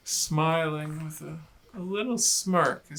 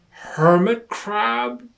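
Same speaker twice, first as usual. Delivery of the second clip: high, slow questioning; puzzled guess; 7 semitones higher, 10 dB louder, slower than usual. Acted. Lion guesses what the rock is, slow puzzled question. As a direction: guess uncertainly.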